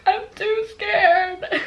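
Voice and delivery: very high, drawn-out mock wail; imitated scared crying. Scared brother refusing to open the door, mock crying wail. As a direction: whimper it slowly like a frightened kid. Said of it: "is too scared to go open the door".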